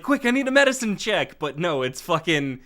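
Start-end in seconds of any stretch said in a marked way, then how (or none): 0.0-1.4 urgent. Pretend. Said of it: "it'd be like".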